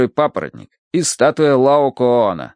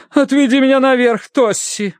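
high, theatrical matron's voice; wearied grand lady. A narrator's reading. Mother asking daughter Тосси for help upstairs, theatrical. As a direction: plead in a high, affected voice. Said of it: "попросила миссис Меринг".

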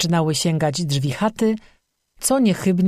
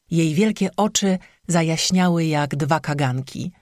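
usual speaking voice

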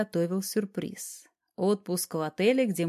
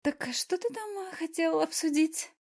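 flustered babbling; 10 semitones higher than usual. A narrator's reading. Flustered wife asks what he wanted discussed, babbling. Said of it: "сбивчиво залепетала".

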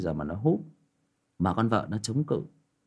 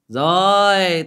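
mock retort; 7 semitones higher, 8 dB louder than usual. Friend's retorted quote.

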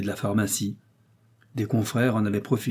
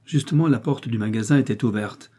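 usual speaking voice

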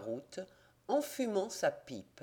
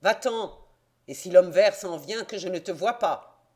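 gruff, louder innkeeper's order; harsh, raised voice sending her off; rough voice; 2 semitones higher, 9 dB louder than usual. A narrator's reading. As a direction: bark her away roughly. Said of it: "d'une voix rude".

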